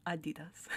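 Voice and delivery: softly repeated Adidas, opening on "ah"; non-English pronunciation. Shown as a demonstration. Testing the brand's real pronunciation to oneself, soft AH-dee-das for Adidas. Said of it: "Isn't it Adidas?".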